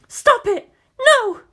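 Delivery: very high, loud scream; desperate scream. A narrator's reading. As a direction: scream to block it out. Aunt screams, covering ears.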